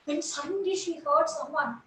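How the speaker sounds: ordinary delivery, nothing marked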